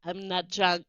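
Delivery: put-on slurred, laughing drunk insistence; a hint of tipsy slurring; drunk slur impression. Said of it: "like, slur impression".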